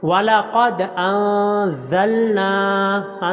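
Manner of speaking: very slow, chanted recitation; careful tajweed chant; slow Quran recitation. Shown as a demonstration. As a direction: draw out the doubled letters in the chant. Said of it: "أَنزَلْنَا کے نون کو لمبا کرنا ہے".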